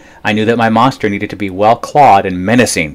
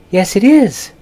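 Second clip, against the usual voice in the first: much higher, prim and proud; high prim monster voice; 13 semitones higher than usual. A narrator's reading. Ralph proudly confirms his nail polish, high and prim. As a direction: answer like a preening dandy.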